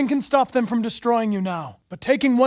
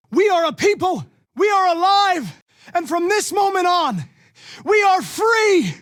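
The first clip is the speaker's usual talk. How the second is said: rallying speech; 12 semitones higher, 7 dB louder than usual. Acted. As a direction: declare freedom to the whole crowd. Leader addressing crowd of androids.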